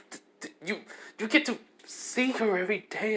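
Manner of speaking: high, squeaky outraged friend voice; jealous classmate. Retold or imitated.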